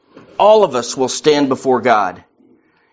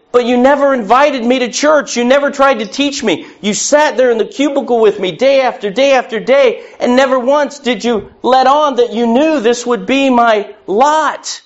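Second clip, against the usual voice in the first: higher, accusing, wounded questions; pleading neighbor; 7 semitones higher than usual. Acted. Neighbor at the throne turning on you, higher and hurt. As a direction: rebuke your friend for never telling you.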